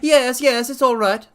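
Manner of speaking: very high, loud threatening squeak; frightened fat patient warning intruders, very high; reedy, quivering voice. Acted. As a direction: threaten from the dark in a high panicked voice. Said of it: "said the voice at last".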